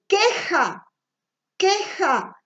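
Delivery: "queja" set apart with a hard k onset; word demonstration. Shown as a demonstration. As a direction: model "queja" clearly, opening k crisp. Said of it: "yo digo".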